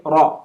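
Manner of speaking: "Ra" with a rounded aw; correct o vowel. Shown as a demonstration. Teacher repeating the letter for students, rounded "Ra". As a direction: round "Ra" into "raw". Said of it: "it's pronounced as o".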